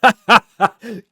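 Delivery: a genuine gruff laugh; rough laugh. Acted. Wolverine laughing at Sephiroth's twitching eye, rough and loud. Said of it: "Wolverine laughs, rough, genuine".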